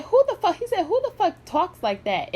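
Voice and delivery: high-pitched, imitated indignant outburst; outraged disbelief. Vlogger quoting a man's shocked reaction, high outraged voice.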